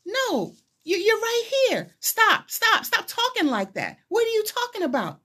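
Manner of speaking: very high-pitched, pleading outburst; distressed protest. Retold or imitated. Follower begging the rabbi to stop talking like that, distressed protest. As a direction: act out refusing to hear it, pitch soaring. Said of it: "And I'm like".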